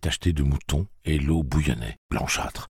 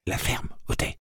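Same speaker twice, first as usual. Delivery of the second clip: hushed, near-whispered hush; murmur; 4 dB quieter than usual. A narrator's reading. Boy silencing his animal, murmur. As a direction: murmur it under the breath. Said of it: "murmura Jake".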